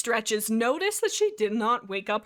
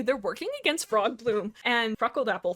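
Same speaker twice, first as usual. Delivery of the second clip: ordinary talk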